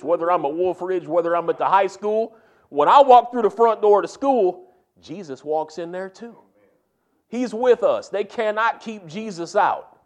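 preaching. Real, not acted. Preaching at full force.

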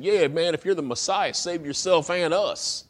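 a dying thief mocking him; mild mocking jeer. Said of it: "began to mock him and said".

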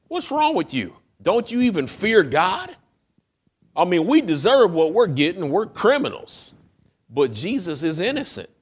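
high, loud rebuke; loud pleading rebuke; rebuking. Acted. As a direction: scold the other thief hard. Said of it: "He rebuked him".

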